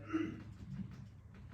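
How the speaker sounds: a dry, breathy clearing of the throat. Acted. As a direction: clear your throat before you speak. Clyde stalls before answering.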